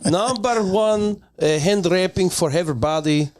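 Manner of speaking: put-on Russian accent. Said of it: "You usually start with the Russian act".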